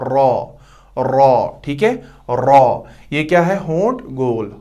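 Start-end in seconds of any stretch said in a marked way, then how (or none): 0.0-2.8 रॉ said with rounded lips, a full, heavy r; heavy raa. Shown as a demonstration. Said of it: "होंठ गोल करने हैं".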